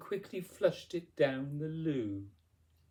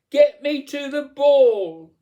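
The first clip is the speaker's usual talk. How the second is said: high, slow, full-force demand; drawn-out imperious yell; shouting; 13 semitones higher, 16 dB louder than usual. A narrator's reading. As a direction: shout it like a furious tantrum. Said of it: "beat her fist against the wall and shouted".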